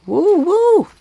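high excited whoops; cheering. Real, not acted. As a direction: cheer them on loudly. Cheering them into the cave.

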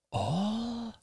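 put-on soft, high, rising child's voice; thick with wonder. A narrator's reading. Son echoes promise of all the sugar, wondering. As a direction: draw out the word in hushed awe, rising. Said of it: "his voice thick with wonder".

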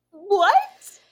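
loud high disbelieving what; shocked what. Real, not acted. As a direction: blurt it out in outrage. Outraged at his excuse.

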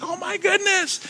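very high, squealing oh my goodness; high-pitched joyful shriek; excited scream. Retold or imitated. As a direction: squeal it like a delighted kid. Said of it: "screaming at the top of my lungs".

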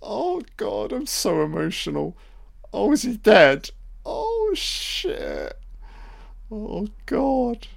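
high-pitched, hammed-up sobbing; mock crying. Mocking an actress attempting a crying sequence, fake weeping. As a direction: overact a tearful breakdown in a squeaky high voice. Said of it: "she's gonna attempt a crying sequence".

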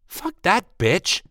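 high, slow spat-out curse; angry curse. Acted. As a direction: spit out the insult. Friend curses the exec.

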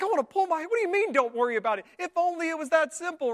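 loud, high-pitched acted exasperation; stressed-out person at breaking point; exasperated outburst. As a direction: throw it back in disbelief. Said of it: "you're basically having a panic attack".

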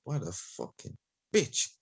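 slow, quiet sneer once the customer is gone; muttered insult. Retold or imitated. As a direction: sneer it after the customer leaves. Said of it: "I'll be like".

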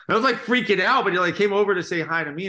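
high, loud and giddy; bubbling over with fan excitement; excited. Real, not acted.

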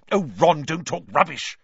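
loud impatient snap; snapping. A narrator's reading. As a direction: cut him off, exasperated. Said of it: "snapped Hermione".

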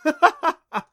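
loud, high guffaw; sudden loud laughing outburst; burst of laughter. Acted. Adam laughing at Clancy's confession, loud burst. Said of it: "Adam burst out laughing".

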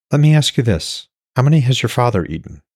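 a hint of her voice, quick and pointed; lightly voiced woman. A narrator's reading. Woman questioning Hoder about his father, lightly voiced.

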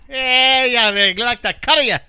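put-on teasing Irish accent bit; mock Irish accent. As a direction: ham up an Irish brogue, playfully threatening. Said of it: "That was actually Irish".

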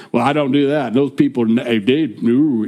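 lightly mocked Southern churchgoer; Southern accent. Preacher mimics self-righteous believers, light Southern accent. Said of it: "put a Southern accent to that".

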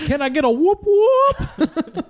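high, slow cheer with a laugh; camp counselor hype. Pretend. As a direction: pump up the campers.

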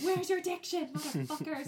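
loud, high-pitched mock outrage; exasperated scolding. Viewer yelling at mumbling cartoon twins, frustrated scolding. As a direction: tell them off at full exasperation. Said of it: "frustrating as fuck".

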